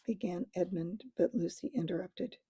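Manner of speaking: ordinary delivery, nothing marked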